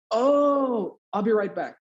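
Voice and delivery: long, high "oh" of comprehension; high-pitched retold surprise; dawning realization. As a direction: imitate a doctor suddenly catching on. Doctor grasps error, hurries off.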